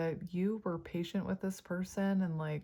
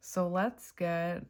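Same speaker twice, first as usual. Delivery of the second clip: ordinary talk, nothing marked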